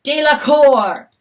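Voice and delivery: slow loud commanding call; bellowed. Acted. Stag calling his fawn, loud bellow. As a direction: boom out the name. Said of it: "bellowed the stag".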